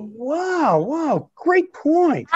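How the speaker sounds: sudden high, overacted wow; overdone surprise. Grandson hurries to please grandmother, overdone surprise. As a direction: fake being impressed.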